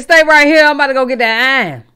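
imitating a street threat, pushed up and rushed; mock Charlotte threat. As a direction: play a Charlotte hothead about to grab a gun, quick and loud.

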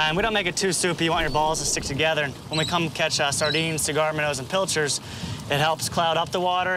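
normal talking voice

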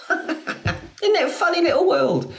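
laughing. Real, not acted. Laughing at chat comment.

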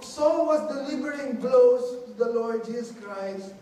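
ordinary delivery

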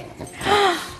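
high-pitched excited gasp; delighted gasp. Real, not acted. Owner spotting a loved one at home, delighted gasp.

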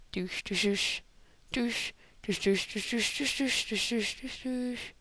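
soft rapid-fire 'dush' sound effects; quiet imitated swishing noises. Gamer making own sound effects while playing, soft and repetitive. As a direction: mouth repeated swish noises under your breath.

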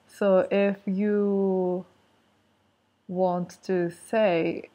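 ordinary talk, nothing marked

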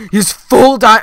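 loud, high burst of alarm; alarmed shout. Real, not acted. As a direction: blurt it out loud and high, cut off. Alarm at opponent's armour.

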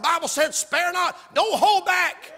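high, forceful pulpit shout; loud preaching. Real, not acted. Preacher defends loud preaching from the Bible, shouting. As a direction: cry it out without holding back. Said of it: "I gotta preach loud".

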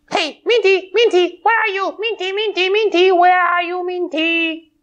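high calling out for a friend; high calling puppet. Acted. Puppet pony searching for Minty, high calling.

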